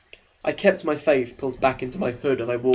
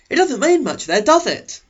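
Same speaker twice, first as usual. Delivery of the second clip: put-on higher, louder eager teen boy voice; eager Eric; 11 semitones higher, 6 dB louder than usual. Eric leaning over to the new girl, eager and high. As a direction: pitch him up high and keen, a chess-club type. Eric makes small talk.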